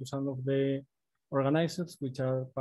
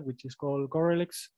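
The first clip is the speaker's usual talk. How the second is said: ordinary delivery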